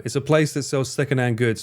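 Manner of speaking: normal talking voice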